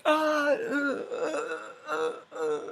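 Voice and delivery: high-pitched hammed-up death whimpers; mock dying groans. Imitating the stabbed king, mock dying groans. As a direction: fake a series of high dying gasps.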